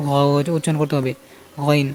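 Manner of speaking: ordinary delivery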